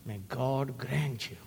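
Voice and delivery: ordinary talk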